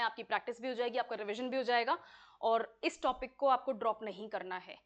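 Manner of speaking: ordinary delivery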